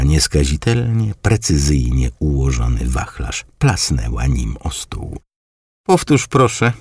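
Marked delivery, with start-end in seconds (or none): 5.9-6.7 high grandmother's voice, pointed; stern grandma. A narrator's reading. Grandmother sternly asks her grandson to repeat himself, voiced high. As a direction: demand he repeat it.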